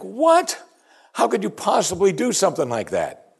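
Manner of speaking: high-pitched shocked "what"; aghast. Acted. Sailors hear Jonah's confession, acted high-pitched astonishment. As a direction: start very high in disbelief, then come down.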